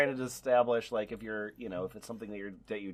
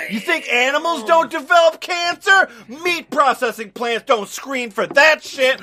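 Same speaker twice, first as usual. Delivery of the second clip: loud, high-pitched outraged yelling; shouted rant; 12 semitones higher, 10 dB louder than usual. Acted. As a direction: shout it like a furious edgy commenter. Edgy comment read out, erupting.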